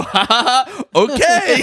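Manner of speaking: high laughing "ha ha ha, okay"; giggly fake-casual okay; awkward laugh. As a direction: laugh it off, caught off guard. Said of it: "I go".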